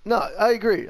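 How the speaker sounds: normal talking voice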